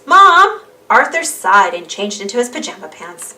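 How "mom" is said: loud, high call across the house; calling for Mom. Acted. D W calls Mom to tattle on Arthur, loud high yell.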